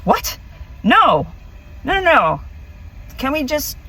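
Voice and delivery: high, flustered no no no; panicked refusal. Retold or imitated. Turning down the alien's ride offer in a high panicked flurry. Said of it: "And I was like".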